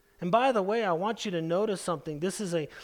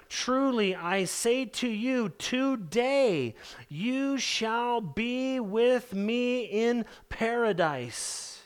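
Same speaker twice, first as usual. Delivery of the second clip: solemn quote of Jesus; 4 semitones higher, much slower than usual. Acted. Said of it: "Jesus said to him".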